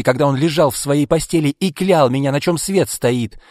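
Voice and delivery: high, loud, bitter tirade; angry rant. A narrator's reading. Izolda rants to Arkady about their father, angry. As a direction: rail at him, loud and pitched high.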